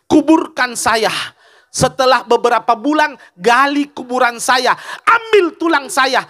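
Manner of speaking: fervent sermon delivery, stretched words; impassioned devoted plea. Acted. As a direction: draw out each plea with fervour.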